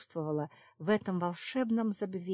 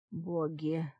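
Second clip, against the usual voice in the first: breathy exhale. A narrator's reading. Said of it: "на выдохе произнёс Йоган".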